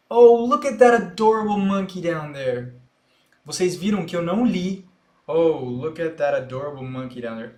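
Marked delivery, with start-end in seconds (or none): 0.1-2.7 louder copy of the movie line; mirroring the clip. Shown as a demonstration. Teacher echoing a movie line for students, mildly excited. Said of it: "vou repetir da mesma forma que ela falou". 5.3-7.5 same intonation as the clip. Shown as a demonstration. Teacher demonstrating shadowing, lightly mimicking the clip. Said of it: "Eu li com a mesma entonação".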